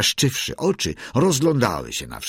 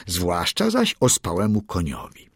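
plain reading voice

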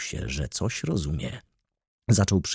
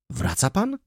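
put-on high, startled young lady's question; exclaimed question; 13 semitones higher than usual. A narrator's reading. Young lady hearing he must turn back, exclaiming in surprise. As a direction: ask it in surprise, pitch leaping up. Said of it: "zawołała panna Izabela".

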